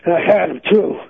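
winded and close to tears; out of breath. Real, not acted. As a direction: heave the words out between breaths. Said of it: "Why are you still out of breath?".

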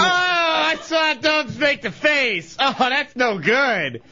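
disgusted shout. Real, not acted. Reacting to shocking article.

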